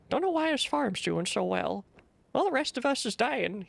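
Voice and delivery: lightly done rural drawl, a bit slow; a hint of a country farmer's accent. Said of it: "he forgot he had an accent".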